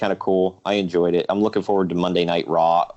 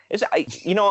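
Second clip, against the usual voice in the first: usual speaking voice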